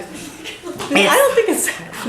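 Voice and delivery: laughing as she disagrees; real giggle through a playful protest; amused, slowed, laughing. Real, not acted. Laughing objection to the speaker.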